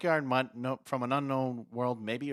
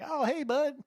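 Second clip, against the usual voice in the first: role; 3 dB louder than usual. Retold or imitated. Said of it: "Jim's like".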